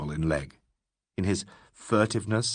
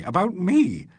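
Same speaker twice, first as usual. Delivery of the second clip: much higher anguished repeat; passionate cry; 9 semitones higher, 4 dB louder, slower than usual. Acted. Legg pleading with Alan, passionately. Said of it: "cried Legg passionately".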